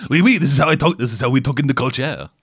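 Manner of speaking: mock French accent, cartoonishly thick. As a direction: ham up a tourist's fake French accent. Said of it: "very stereotypical French accent".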